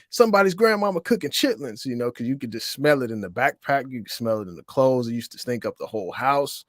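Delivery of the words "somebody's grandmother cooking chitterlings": put-on kid yelling out. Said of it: "another kid yelled out".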